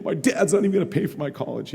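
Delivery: high, put-on whine; mock self-pitying complaint; playing the victim. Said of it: "playing the victim".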